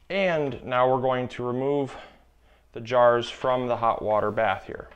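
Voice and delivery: ordinary delivery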